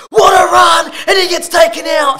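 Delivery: high, loud mock commentator shout; mock commentator call. Imitating a TV commentator, mock excited shout.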